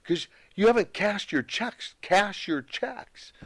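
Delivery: high-pitched exasperated accountant; mock exasperation, pitched high; exasperated nagging. Retold bookkeeper nagging about uncashed checks.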